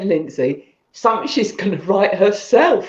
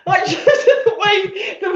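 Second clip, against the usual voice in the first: laughing; 11 semitones higher, slower than usual. Real, not acted. Giggling fit while thanking.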